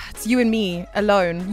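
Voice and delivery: usual speaking voice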